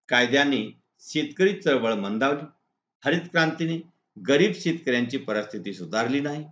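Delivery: normal talking voice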